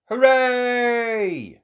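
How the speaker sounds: high loud cheer. Acted. Children cheering for the adventure, high loud cheer. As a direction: cheer with joy. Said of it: "cheered Peppa and her friends".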